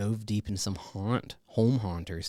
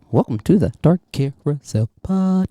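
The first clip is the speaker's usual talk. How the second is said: announcer; 4 semitones higher, 12 dB louder than usual. Acted. Co-host shouts the show name.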